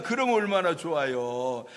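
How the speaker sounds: ordinary talk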